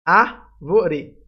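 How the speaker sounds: "Árvore" with a loud opening Á; stress on Á. Shown as a demonstration. Teacher showing where the accent falls, stress on Á in "Árvore". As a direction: hit Á, let the rest of "Árvore" fall away. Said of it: "A sílaba mais forte é o A".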